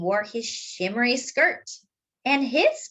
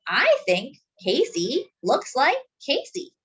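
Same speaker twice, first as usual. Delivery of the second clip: smiling, higher warm mother voice; 5 semitones higher than usual. Acted. Mama smiling at Casey, fond and gentle. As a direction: say it with a fond smile. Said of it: "smiling at him".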